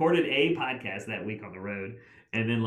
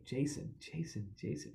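hushed repeated name; 12 dB quieter, slower than usual. Retold or imitated. Someone answers quietly who it is.

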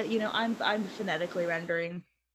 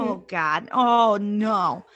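loud acted dismay; hammed-up panic, getting louder; alarmed; 8 dB louder, much slower than usual. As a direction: cry out in mock alarm. [name] panicking on seeing family.

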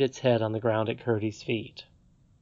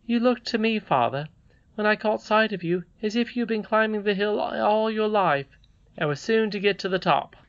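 put-on high, light storybook boy; earnest young son; 8 semitones higher than usual. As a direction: speak up to father in a higher voice. Voices Curdie in story.

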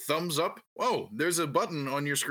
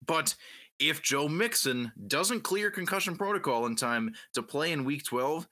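usual speaking voice

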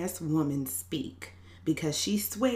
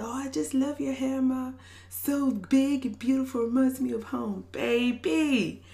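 lightly imitated Jamaican man, pitched higher; Jamaican accent; 7 semitones higher, 4 dB louder than usual. Stranger complimenting a woman's hair in line, light mock Jamaican accent. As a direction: flatter her with a playful hint of a Jamaican accent. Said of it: "he says in a Jamaican accent".

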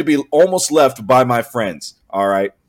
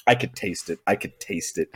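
low and much softer, repeated; hushed intensity; 6 semitones lower, 10 dB quieter than usual. Real, not acted. Storyteller recalling anger at his friends, hushed intensity. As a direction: drop right down, almost to yourself.

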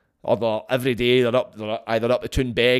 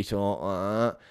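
slow, soft imitation of a slack-throated addict; mimicked drug-user drawl, quiet and slow; heroin addict's drawl; 4 semitones lower, 5 dB quieter, slower than usual. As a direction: drag the words out with a relaxed larynx. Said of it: "larynx in your throat being relaxed".

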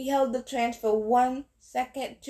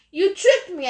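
higher, louder, furious accusation; angry accusation; 8 semitones higher, 6 dB louder than usual. A narrator's reading. Percy accuses Ares, angry and raised. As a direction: fling the accusation at him.

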